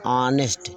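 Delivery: ऑनेस्ट said alone, starting on the vowel; silent H. Shown as a demonstration. Teacher repeating the reading for students, ऑनेस्ट with silent H. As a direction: say ऑनेस्ट on its own with no H. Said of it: "इसे ऑनेस्ट पढ़ रहे हैं".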